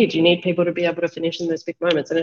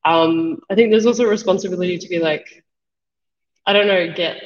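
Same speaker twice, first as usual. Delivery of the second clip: normal talking voice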